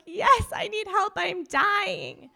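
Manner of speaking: high, loud, panicked wail; panicked cry. Retold or imitated. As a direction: beg for help like you're really dying. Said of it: "And I'm like".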